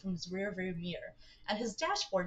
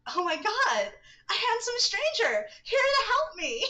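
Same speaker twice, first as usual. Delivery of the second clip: mock gushing; 13 semitones higher, 6 dB louder than usual. Gushing over the handsome stranger.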